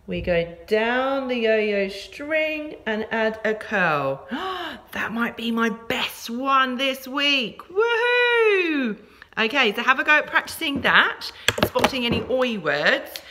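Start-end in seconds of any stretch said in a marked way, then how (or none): 4.3-4.9 high, soft intake of breath; amazed little gasp; delighted gasp. Real, not acted. Mock amazement at her own letter. 7.7-9.0 high, long falling woohoo; gleeful high cheer; celebratory whoop. Real, not acted. Teacher celebrates her best one.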